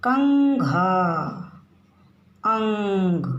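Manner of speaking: कंघा, अंग drawn out slowly, ं held; teacher modelling कंघा and अंग; slow nasal demo. Shown as a demonstration. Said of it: "छोटा सा सुनाई दे रहा है स्वर".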